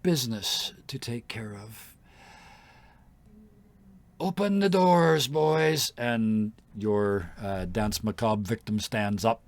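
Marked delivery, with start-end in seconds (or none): 0.0-2.0 breathy whispered aside. Acted. Queen hints at her business, whispered. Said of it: "and she kinda". 4.2-5.9 high, loud command to the guards. Acted. Queen orders guards to open doors, commanding call.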